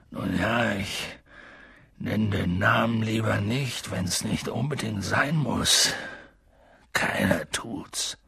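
low, soft, hesitant reply; shy away, nearly whispering at the end; reluctant. A narrator's reading. Giant dodging the feared name, hushed reluctance.

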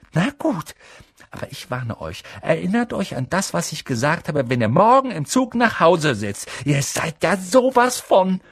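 indignant schoolgirl; warning girl. A narrator's reading. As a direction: threaten them primly. Hermine warns them.

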